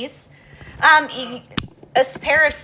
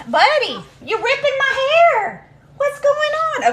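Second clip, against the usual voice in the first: pet talk; 12 semitones higher than usual. Real, not acted. Bird pulling her hair.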